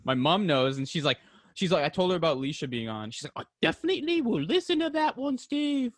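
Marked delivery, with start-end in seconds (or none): 3.4-5.9 high mock mom voice; chirpy high impression of his mother; an exaggerated high mock mom voice, well above his own; imitating mom. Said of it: "It's not how your mother sounds".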